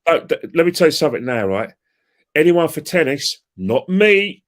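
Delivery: loud, high insistent burst; rising to a high, loud peak on not me; excited interrupting. Real, not acted. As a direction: push in over him, all fired up. Cuts in to make his point.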